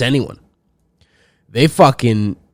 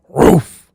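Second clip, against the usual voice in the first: mock bark; 2 semitones higher, 4 dB louder than usual. Mock dog bark after meme.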